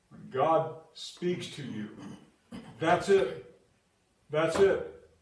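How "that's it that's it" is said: higher and louder, final; two loud "that's it"s; emphatic punch. Acted. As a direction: slam the point home.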